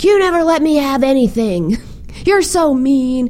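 high-pitched childish outburst, mimicked; tearful little boy. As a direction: wail it at Mom like an upset kid. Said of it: "he started to cry".

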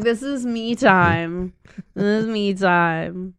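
slow, deliberate insistence; drawn-out retort. Retold or imitated. Narrator telling herself off about kids, slow and firm. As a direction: stretch each word out firmly. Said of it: "I'm like".

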